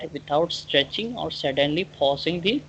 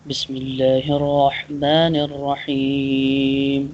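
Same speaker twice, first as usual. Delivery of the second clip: Arabic recitation; 4 dB louder, faster than usual. Shown as a demonstration. Teacher recites Arabic formula.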